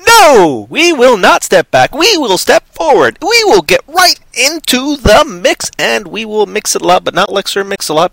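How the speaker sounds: high, loud, slow rallying cry; mock-heroic defiance. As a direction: declaim it like a grand battle speech. Mock-defiant reply to stepping back.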